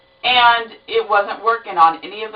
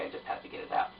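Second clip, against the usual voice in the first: whisper; 16 dB quieter, faster than usual. Real, not acted. Confessing why she taped this.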